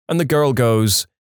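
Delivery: usual speaking voice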